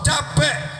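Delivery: ordinary delivery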